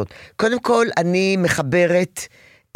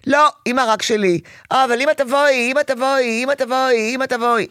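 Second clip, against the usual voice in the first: put-on high pleading child's call; whiny child; 8 semitones higher than usual. Counselor imitating a needy girl calling her mother. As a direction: beg mom to come, whining. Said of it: "שואלת את עצמה".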